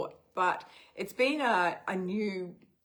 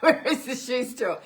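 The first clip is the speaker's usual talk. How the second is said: laughing; 6 semitones higher, 7 dB louder than usual. Retold or imitated. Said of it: "That was all Sam could say".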